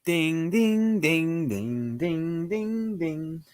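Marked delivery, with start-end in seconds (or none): none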